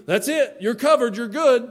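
loud, mocking reassuring voice; mock comforting with a sneer; mock reassurance. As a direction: mimic the smug man who says you're covered. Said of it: "some other man on earth tell you".

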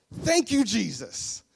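loud, high exclamation of praise; jubilant thanks. Acted. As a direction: burst out in gratitude, then fall. Pastor's jubilant answer again.

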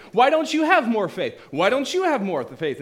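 loud, high accusing yell; accusing shout. Acted. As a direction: throw the blame at them loudly. Accusing crowd shouting at disciples.

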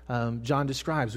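usual speaking voice

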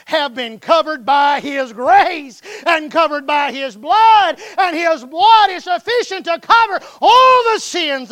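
loud, slow preaching swell; preaching at full force. Acted. Preaching climax.

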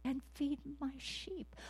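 high, soft, slow commission; voice of God. Acted. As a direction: hand over the charge gently, high and slow. Voicing God's commission.

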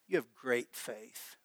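slow, soft and low; tender. Retold or imitated. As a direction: say it like a gentle blessing. Jesus quoted to centurion.

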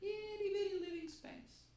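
high squeaky voice. Retold or imitated. Genie quote, tiny space contrast.

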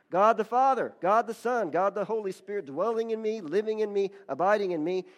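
high, loud declamatory praise; impassioned preacher praying aloud; fervent thanksgiving. Retold or imitated. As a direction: proclaim it in rolling phrases, swelling to a ringing peak. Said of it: "started giving thanks to God like that".

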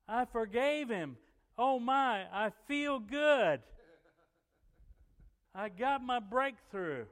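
very high, loud, stretched-out elation; acting out her ecstatic outburst; jubilant shout. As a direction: shout it out in joyful release. Retold woman's joy at breakthrough.

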